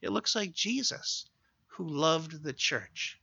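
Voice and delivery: ordinary delivery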